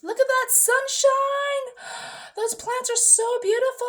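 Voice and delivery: very high, drawn-out squeal; hammed-up gushing. Vlogger admiring sunshine and plants, mock-ecstatic. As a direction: coo it in a sustained high mock rapture.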